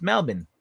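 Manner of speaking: Melbourne said without the R, "Mel-bn"; Melbourne with the R left out. Shown as a demonstration. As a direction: drop the R in Melbourne, short and falling. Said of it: "you don't pronounce the R".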